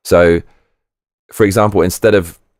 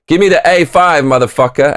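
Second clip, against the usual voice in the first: swaggering customer; 8 semitones higher than usual. Pretend. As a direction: bark the order like a brash customer. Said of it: "I pretended I was the customer".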